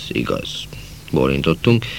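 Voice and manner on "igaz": much softer, lower repeat; a soft afterthought agreement; soft echo. A narrator's reading. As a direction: murmur it back quietly. Quiet echoed agreement.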